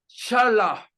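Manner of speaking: Shalach said sha-LA, open final vowel; partial word sounded out. Shown as a demonstration. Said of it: "hasta aquí estamos escribiendo".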